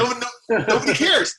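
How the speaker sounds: high whiny mock complaint; mock whine. Exaggerated self-pity, joking.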